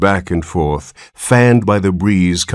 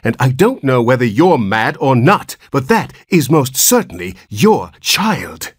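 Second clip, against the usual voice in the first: higher-pitched, emphatic rebuke; severe nurse; 7 semitones higher than usual. A narrator's reading. Nurse insists to the father it is his child, severely. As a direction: lay it down severely, word by word. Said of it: "replied the nurse severely".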